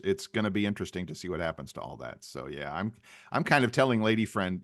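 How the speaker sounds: normal talking voice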